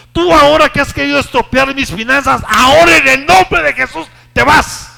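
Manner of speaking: builds from firm to high, loud commanding shout; rising rebuke to a shout. Acted. Preacher commanding illness and trouble to leave. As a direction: cast it out with full force. Rebuking a problem in Jesus' name.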